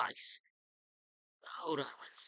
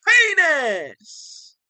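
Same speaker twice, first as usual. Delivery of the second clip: loud, high shouted outburst; sudden loud high yell; 9 semitones higher, 9 dB louder than usual. Acted. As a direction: yell it out of nowhere.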